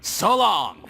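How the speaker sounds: mocking farewell. Villain leaves trapped heroes.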